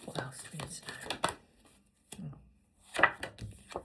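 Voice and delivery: soft unvoiced whisper. Real, not acted. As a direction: whisper the question to yourself. Quiet aside while drawing cards.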